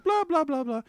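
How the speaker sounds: high-pitched blah-blah babble; singsong high mimicry of chatter; mock chatter. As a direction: imitate someone yapping away, high up.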